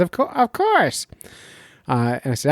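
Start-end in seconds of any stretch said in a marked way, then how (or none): 0.0-1.1 very high put-on doctor voice; mock doctor voice. Doctor friend agrees to be quoted, mock doctor voice. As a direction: pitch it way up as a caricatured doctor. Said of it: "I'll do the voice work for him".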